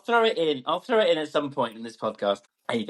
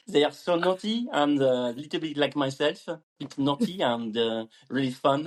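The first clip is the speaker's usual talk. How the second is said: hesitant put-on French accent. Shown as a demonstration. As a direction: speak English like a Frenchman feeling for words. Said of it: "in a French accent".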